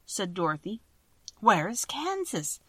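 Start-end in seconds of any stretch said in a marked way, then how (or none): 1.4-2.6 surprised. Acted. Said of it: "asked the man with surprise".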